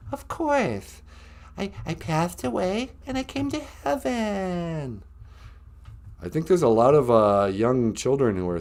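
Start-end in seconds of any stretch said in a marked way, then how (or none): 0.1-5.0 high, softer falsetto impersonation. Acted. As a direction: coo gently in a pop star's falsetto. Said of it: "It's me, [name]".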